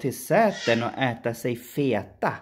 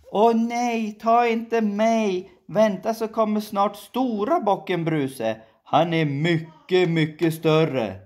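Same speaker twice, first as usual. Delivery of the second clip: middle goat pleading; 9 semitones higher than usual. Acted.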